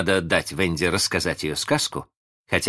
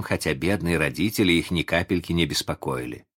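plain reading voice

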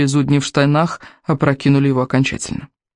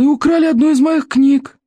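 put-on cranky old librarian; 11 semitones higher than usual. A narrator's reading. Голос библиотекаря. (сварливый старик-библиотекарь, высоко и протяжно)

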